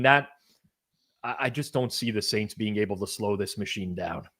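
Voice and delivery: usual speaking voice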